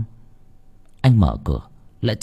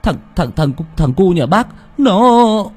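stammering; 9 semitones higher than usual. Acted. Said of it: "Thằng Tuyền ấp úng".

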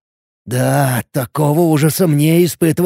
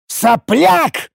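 "Сопляк!" as a high, furious outburst; яростный рык; 11 semitones higher than usual. A narrator's reading. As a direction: explode on "Сопляк!", choking with rage. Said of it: "захлёбываясь от ярости, рычал я". («Сопляк!», захлёбываясь от ярости)

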